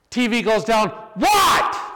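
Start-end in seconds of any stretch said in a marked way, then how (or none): 1.1-1.8 mock shout. Girls yelling through door.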